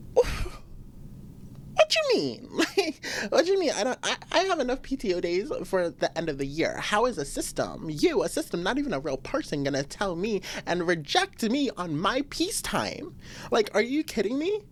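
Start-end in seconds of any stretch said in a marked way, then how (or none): 0.1-3.7 high squeaky disbelief, much higher; incredulous. Real, not acted. Outraged at rejected PTO. 13.5-14.6 indignant disbelief. Real, not acted. Indignant at the system.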